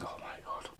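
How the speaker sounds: whispered in disbelief; stunned whisper. Retold or imitated. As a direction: barely breathe it out. Reaction to shark killing people.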